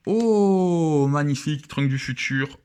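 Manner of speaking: long, loud, high "oh" sliding down; excited awe. Real, not acted. Fan reveals Trunks card, excited awe. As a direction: cry out in delighted admiration. Découvre une carte rare magnifique.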